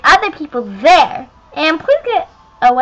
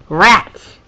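ordinary delivery

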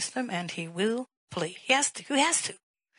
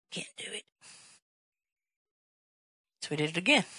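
usual speaking voice

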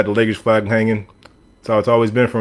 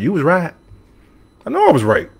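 put-on Southern accent, pitched higher; 6 semitones higher than usual. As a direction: mimic them admitting it in a Southern accent. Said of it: "in a Southern accent".

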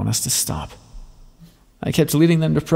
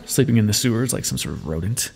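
ordinary talk

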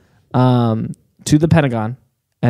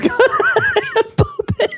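laughing; slower than usual. Real, not acted. Laughing through the line.

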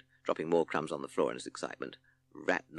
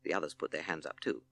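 usual speaking voice

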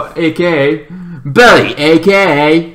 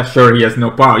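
ordinary talk, nothing marked

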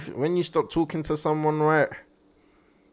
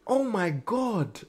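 stunned, slow; 7 semitones higher, 3 dB quieter, much slower than usual. Real, not acted. Stunned reaction.

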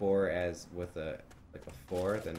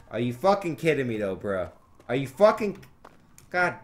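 frustrated outburst; 3 semitones higher, 7 dB louder than usual. Real, not acted. Frustrated at game death.

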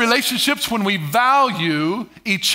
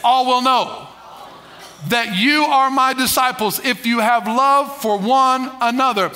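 congregation call-back; 3 semitones higher, slower than usual. Shown as a demonstration. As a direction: lead the crowd in saying it back, slowly. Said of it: "Everybody say".

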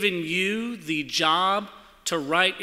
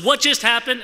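alarmed question; 6 semitones higher, 9 dB louder than usual. Retold or imitated. Retelling a shocking accident.

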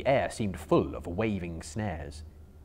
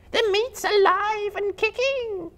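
high, very slow, louder spider voice; 6 dB louder, slower than usual. Acted. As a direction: spiders laughing at their fallen friend. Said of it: "they said".

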